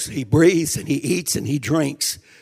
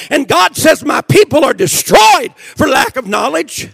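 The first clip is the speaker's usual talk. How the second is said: high, loud revival preaching; impassioned, raised preacher's voice; full-force preaching; 9 semitones higher, 8 dB louder than usual. Real, not acted. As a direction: preach it hard to the whole church.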